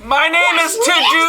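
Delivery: high, bright, sing-song character greeting; bright squeaky sing-song welcome. Acted. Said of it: "in your character voice".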